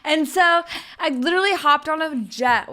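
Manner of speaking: amused, laughing recount. Real, not acted.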